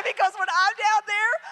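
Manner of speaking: high, loud and excited; excited squeal. Retold or imitated. Mother telling her daughter the news, high and loud. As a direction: squeal it like you can't contain it.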